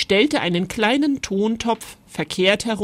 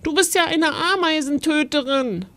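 höher, vorwurfsvolle Kinderstimme; Mama empört anklagen; empörtes Kind; 8 semitones higher than usual. Acted. (leicht empörte Kinderstimme, höher)

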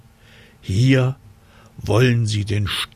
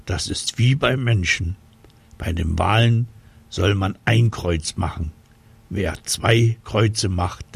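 plain reading voice

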